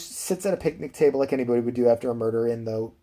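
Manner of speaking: ordinary talk, nothing marked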